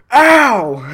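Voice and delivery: sharp, very loud high cry; imitating a hurt coworker's yelp; pained yelp. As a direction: yelp as the oysters hit. Hit by flying oysters.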